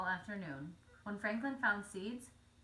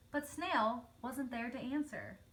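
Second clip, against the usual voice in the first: ordinary delivery, nothing marked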